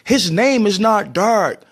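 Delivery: high-pitched kids' voice, imitating little children; kids correcting the teacher. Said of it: "my little country kids was like".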